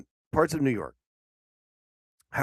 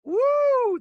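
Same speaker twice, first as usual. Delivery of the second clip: whooping cheer, much higher; high loud woo; 7 dB louder than usual. Real, not acted. Cheering to wake up.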